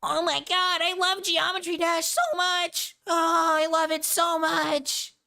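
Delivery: very high, slow gushing fan voice; gushing fangirl. Retold or imitated. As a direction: squeal like an obsessed fan. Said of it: "who's like".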